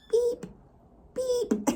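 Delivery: high squeaky little beeps; playful beeps. Real, not acted. Owner playing with a baby animal, high beeps.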